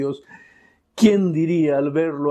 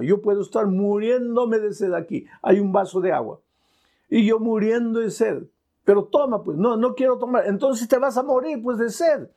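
impassioned sermon, rising and falling; fervent preaching; 5 semitones higher than usual. Acted. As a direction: preach with full conviction. Evangelical preaching. (predicación apasionada)

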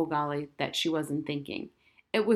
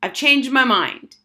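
loud, slow and higher, every word firm; loud declaration; 7 semitones higher, 10 dB louder, slower than usual. Acted. Girl announcing to her parents at coffee, loud and firm. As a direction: announce it to the whole room. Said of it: "said in a loud voice".

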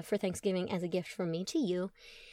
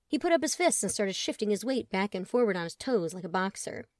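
plain reading voice

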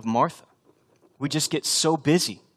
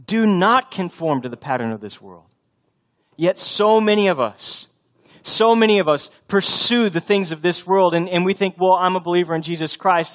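louder, higher, driving preacher's voice; full-force preaching; 6 semitones higher, 6 dB louder than usual. Acted. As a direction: preach it out to the congregation.

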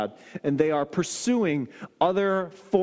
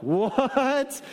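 second disbelief; 7 semitones higher than usual. Retold or imitated. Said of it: "I'm like".

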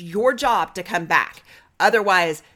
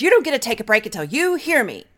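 higher and louder, a demanding retort; mock demanding partner; 6 semitones higher, 5 dB louder, faster than usual. Angry partner chasing after the one taking a break, demanding. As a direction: play the partner who won't let them leave.

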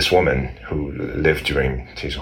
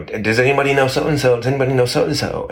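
higher-pitched asking around, repeated plea; searching wife; 9 semitones higher than usual. Retold or imitated. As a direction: ask everyone, lightly acted. Wife asks around at the wall.

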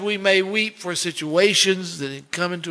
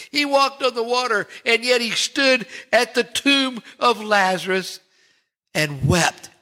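higher, building sermon climax; rising preaching; 5 semitones higher than usual. Acted. Preacher extolling Jesus to congregation, higher rousing preach. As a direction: lift the congregation toward the big turn.

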